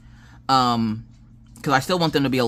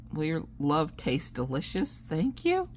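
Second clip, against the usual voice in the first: slow, softer, rising to a high thank-you; imitated wary politeness; 4 semitones higher, 8 dB quieter, slower than usual. Son scared to eat it, imitated wary thanks. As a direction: force out polite thanks, hesitantly.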